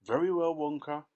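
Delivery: loud, high pompous address; pompous father addressing Wonka; self-important. A narrator's reading. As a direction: announce it like a rich man. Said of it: "he said importantly".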